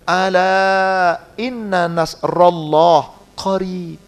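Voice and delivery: slow chanted Arabic recitation; Quran recitation. Acted. Preacher reciting the verse to the congregation, slow chant. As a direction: intone the verse slowly like tilawah.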